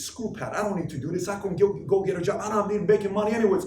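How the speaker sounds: put-on petulant voice, speeding up at the end; bratty attitude. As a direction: imitate someone defiantly quitting in a huff. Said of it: "If she has an attitude that day and says".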